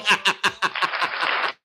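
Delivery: high cackling laugh. Real, not acted. Guest amused by his own wording, high laugh. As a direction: cackle at your own words.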